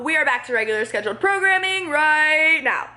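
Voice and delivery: put-on TV continuity voice; mock announcer. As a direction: announce the return to the show, playfully high. Mock broadcast return after aside.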